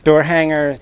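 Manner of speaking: ordinary talk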